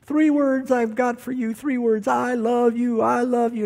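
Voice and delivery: high, hammed-up drunken pleading from below the window; mock-bellow it up to her window; drunk husband's bellow. Drunk husband pleading up to his wife's window, hammed-up high bellow. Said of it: "he'd bellow upstairs to her window".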